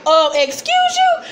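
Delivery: very high, incredulous squeak; mock shocked disbelief. Daughter hearing parents' confession, high mock disbelief. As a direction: imitate someone taken aback, voice shooting up.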